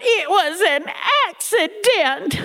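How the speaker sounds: high, slow pleading granny; granny crying. Retold or imitated. Granny insisting it was an accident, high and pleading. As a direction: plead innocence like a caught grandmother. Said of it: "And Granny cried".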